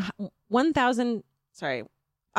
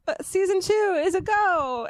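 excited announcement; 12 semitones higher, 3 dB louder, slower than usual. Retold or imitated. Dream email announcement.

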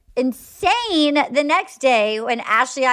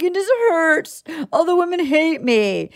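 mock sobbing; 5 semitones higher than usual. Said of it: "immediately starts sobbing at the bar".

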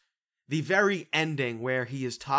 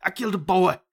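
higher, louder hammed-up confession; mock dramatic confession; 6 semitones higher, 6 dB louder, faster than usual. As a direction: blurt it like a big reveal. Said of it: "And he's like".

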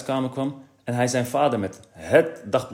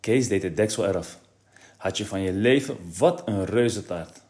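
plain reading voice

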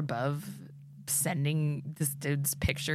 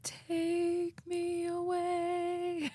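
dreamy plea; 12 semitones higher, 4 dB quieter, much slower than usual. Pretend. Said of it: "I'd be like".

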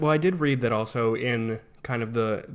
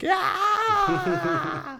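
very high laughing yell; evil triumphant shout; 3 dB louder than usual. Retold or imitated. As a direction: cackle out a villain's gleeful cry. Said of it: "I wouldn't be like".

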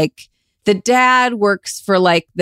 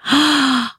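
surprised gasp; 8 semitones higher than usual. Real, not acted. Surprised by hidden podcast.

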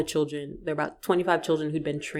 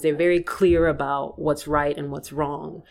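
usual speaking voice

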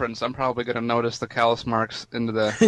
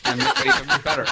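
laughing; 4 dB louder, faster than usual. Real, not acted. Joking, laughing exaggeration.

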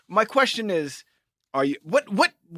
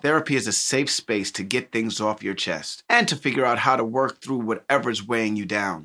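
lower, even sponsor read; 9 semitones lower than usual. Acted. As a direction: read the ad smoothly.

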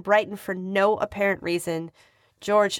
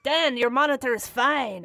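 higher, mock French accent; 5 semitones higher than usual. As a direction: reassure in a fake French accent. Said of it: "for that French accent".

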